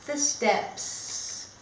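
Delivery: lively, sing-song story reading; reading to children. Acted. Teacher reading a picture book to her class, bright and animated. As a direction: read it like a storybook for little kids.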